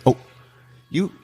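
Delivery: ordinary delivery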